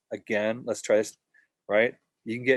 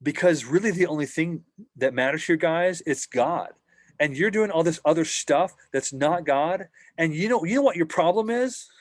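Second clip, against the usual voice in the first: higher, louder preachy scolding; judgmental priest; 6 semitones higher, 4 dB louder than usual. Retold or imitated. Priest judging the team, higher and louder preachy scold. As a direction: lecture them like a self-righteous priest. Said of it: "the priest can get judgmental".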